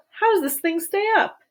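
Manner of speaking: high worried question; anxious cry. A narrator's reading. Grandpa Joe asking anxiously how they float. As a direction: ask in alarm. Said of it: "cried Grandpa Joe".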